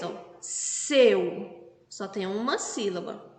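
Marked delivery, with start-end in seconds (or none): 0.4-1.8 "Seu" said as one syllable in a single beat. Shown as a demonstration. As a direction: say "Seu" all at once, in one go. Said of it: "Quando eu pronuncio seu, eu pronuncio de uma, uma só vez". ("Seu" pronunciado de uma só vez)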